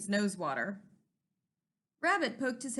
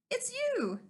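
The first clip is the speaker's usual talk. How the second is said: relieved porcupine; 12 semitones higher than usual. Acted.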